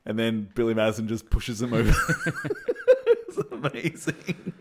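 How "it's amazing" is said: breaking up with laughter, drawn out and higher; slow words squeezed through real laughter; laughing. Real, not acted. Cracking up at the scene.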